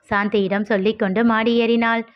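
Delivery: usual speaking voice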